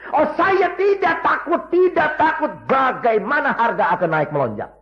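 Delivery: high, laughing, unafraid boast; loud, cheerful confidence; laughing defiance. Acted. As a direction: laugh off the price rises. Believer boasting no fear.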